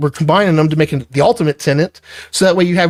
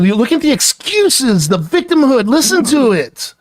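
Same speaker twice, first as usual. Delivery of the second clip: pitched higher and pressing, exasperated; scornful scolding; 6 semitones higher than usual. Real, not acted. Boss lashing out at employee over his excuses, higher exasperated scolding. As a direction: rip into the excuses with rising contempt.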